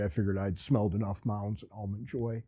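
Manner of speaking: ordinary delivery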